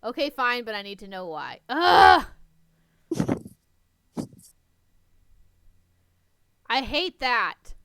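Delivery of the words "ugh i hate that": loud frustrated groan. Real, not acted. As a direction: let out real exasperation. Reader frustrated by cliffhanger.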